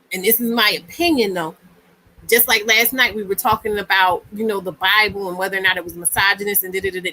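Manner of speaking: usual speaking voice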